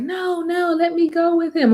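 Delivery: put-on high, pleading girlish voice; mock Elizabeth begging to go. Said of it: "And Elizabeth was like".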